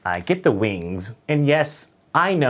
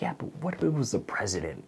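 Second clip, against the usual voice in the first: hushed; 9 dB quieter, faster than usual. Retold or imitated. Whispered reply to manager.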